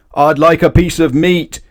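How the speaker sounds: loud, high booming demand. A narrator's reading. Mister Noisy booms at the butcher. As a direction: boom it out. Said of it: "boomed Mister Noisy".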